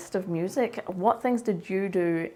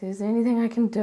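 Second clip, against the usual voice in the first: quick, higher, louder voice of the worried husband; Martin's quick eager offer, pitched up and louder; caring husband; 5 semitones higher, 5 dB louder, much faster than usual. Retold or imitated. Husband asks his sick wife if she needs anything, quick and eager.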